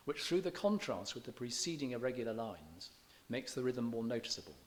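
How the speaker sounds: ordinary talk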